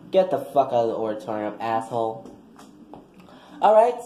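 usual speaking voice

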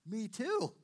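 loud, high burst of joy; high, excited whoop of agreement; delighted exclamation. Acted. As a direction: exclaim it in thrilled recognition. Delighted discovery of shared faith.

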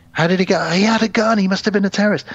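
high-pitched mock alarm from gossiping passengers; agitated passengers, imitated. Passengers discuss the incident, acted out.

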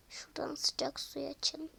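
hushed aside; whisper. Acted. Turning on the hot water for the doll, whispered. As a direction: whisper it to yourself.

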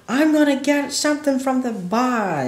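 high, loud mock shout of a hard-of-hearing man; mock deaf shouting, pitched high. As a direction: imitate a deaf man shouting across the room. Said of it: "And he's shouting".

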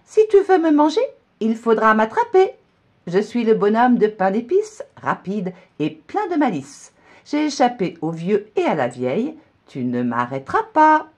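high, loud taunting rhyme; cheeky gingerbread man. A narrator's reading. Gingerbread man taunting the cow, high and loud. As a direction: boast as you run off. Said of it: "le bonhomme répond".

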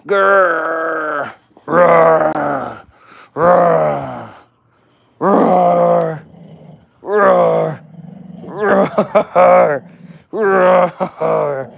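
long, drawn-out mock roars; playful growl. Owner egging on the dog to rip the toy, playful growling. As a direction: growl and roar like a pretend beast.

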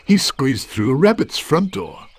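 ordinary delivery

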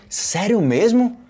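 acted astonishment, pitched well up; incredulous questioning. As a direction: question it as if you can't believe it. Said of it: "coisa que eu não acredito".

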